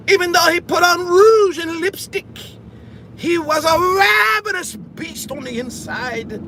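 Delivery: mock shout. Hammed-up boast about grandpappy.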